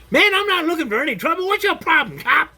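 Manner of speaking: high, fast and loud, sneering at the cop; mock belligerent punk. Shown as a demonstration. What not to say to a hostile cop, belligerent sneer. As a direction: play the mouthy kid picking a fight. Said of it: "I don't mean".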